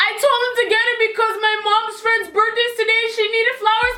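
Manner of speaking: high, fast, rambling retold plea; tearful begging. Explaining flowers to cousin, retold panicked begging. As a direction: gabble a high-pitched excuse and beg. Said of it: "crying at this point".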